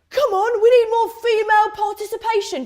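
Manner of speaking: loud, high rallying cry; rallying campus activist. Acted. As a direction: call out to the crowd like a keen activist. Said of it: "The campus community activist".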